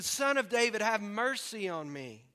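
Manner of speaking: loud, high plea slowing at the end. Retold or imitated. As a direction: cry out insistently, acted shout. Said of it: "he only shouted louder".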